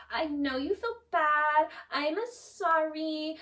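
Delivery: slow, drawn-out show of sympathy; mock apologetic. [name] apologizing in front of the guys, mock sincere. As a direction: stretch out a put-on, insincere sorry. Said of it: "tries to look good in front of the guys".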